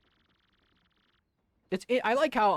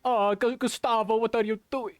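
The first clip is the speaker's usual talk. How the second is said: put-on a loud, higher Italian accent Flavio; 6 semitones higher, 5 dB louder than usual. Streamer showing his Italian Flavio voice to chat. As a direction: call out to Gustavo in an Italian accent. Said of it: "like, I do a Flavio".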